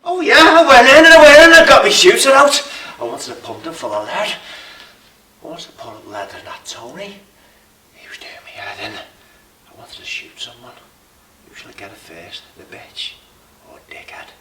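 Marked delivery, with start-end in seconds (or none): 0.0-2.7 high, loud gleeful roar about his gun; manic shout. Acted. Gunman boasting to the room, manic shout. 5.5-14.3 menacing whisper. Acted. Gunman confessing his urge to kill, menacing whisper.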